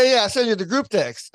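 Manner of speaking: usual speaking voice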